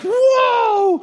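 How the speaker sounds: very high gleeful whoa with a laugh; delighted child's squeal; thrilled squeal. Retold or imitated. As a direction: squeal like a kid flying through the air. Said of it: "they're just like".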